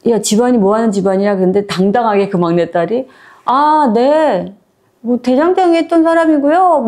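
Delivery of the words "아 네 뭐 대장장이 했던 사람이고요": boldly confident. Retold or imitated. Youngest daughter boldly answering her status-proud in-laws, high and unashamed. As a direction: state it proudly, no shame at all. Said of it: "너무 당당하게 얘기하는 거야".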